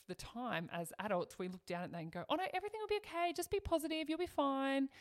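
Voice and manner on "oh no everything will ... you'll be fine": high-pitched, chirpy mock reassurance; mock cheerful adult, high voice; dismissive reassurance. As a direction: imitate an adult breezily brushing it off, pitched way up. Adults brushing off struggling youngster.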